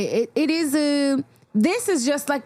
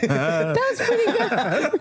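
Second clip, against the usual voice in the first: laughing; 3 semitones higher, slower than usual. Real, not acted. Laughing at the joke.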